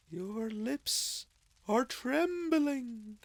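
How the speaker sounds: high, slow, otherworldly spirit; ghostly spirit voice. Acted. Ghost of Christmas Past solemnly summoning Scrooge, high and slow. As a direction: speak from beyond, high and drawn out.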